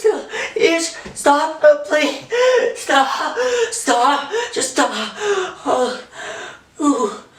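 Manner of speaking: terrified, half-mad shrieking; panicked screaming. Acted. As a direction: scream in terror at the blood. Sudden bloody hallucination, panic.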